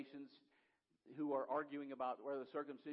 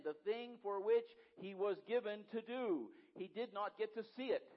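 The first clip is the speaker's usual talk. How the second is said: higher, louder, driving sermon build; fiery preaching; 6 semitones higher, 5 dB louder than usual. Acted. Pastor driving home Moses' failure, fiery and loud. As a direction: hammer the point home to the congregation.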